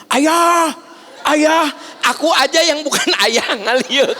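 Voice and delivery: put-on child yelling for father; child shouting in mall. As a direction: call out for dad across the mall. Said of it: "Kabayang teu".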